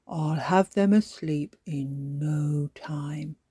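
slow, confidential whisper; quiet whisper. A narrator's reading. Daddy Pig whispering confidently to Grandpa Pig. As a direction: whisper it slowly, as a secret boast. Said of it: "he whispered quietly".

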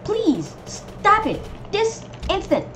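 high-pitched mock scolding; exasperated pleading. Gamer telling enemies to stop, high exasperated plea.

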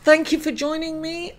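ordinary talk, nothing marked

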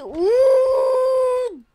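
drawn-out startled shout; surprised shout. Real, not acted. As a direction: yell out in sudden surprise. Sudden game surprise.

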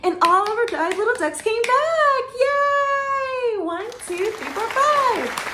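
high, loud, joyful cheer; excited cheering. Real, not acted. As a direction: celebrate the happy ending. Happy ending, cheering.

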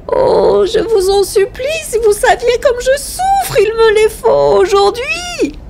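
very high, drawn-out wail; anguished begging, stretched and high; tearful pleading. A narrator's reading. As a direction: implore desperately in a high, slow wail. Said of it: "elle pleurait, bégayait, harcelée".

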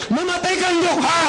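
high, hammed-up curse at the refuser; mock curse. Recruiter jokingly cursing the prospect who said no, hammed up. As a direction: spit it out like a joking hex.

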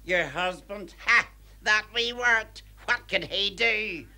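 high, slow, sneering scorn; scornful mockery. Cleaner sneering at the employer's husband. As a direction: pour scorn on the threat.

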